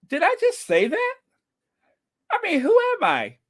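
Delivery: high squeaky mock shock; mock disbelief. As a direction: act stunned by your own words. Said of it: "take another sip and see what happens".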